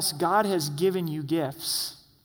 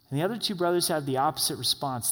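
ordinary delivery

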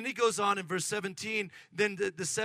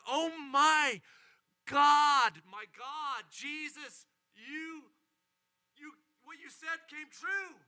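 high, amazed, dropping to hushed awe; excited disciples; 6 semitones higher, slower than usual. Acted. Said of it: "The disciples are so excited".

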